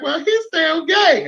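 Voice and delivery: high, slow and matter-of-fact; the mother laying it down slowly; knowing mother. Retold or imitated. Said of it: "she was like".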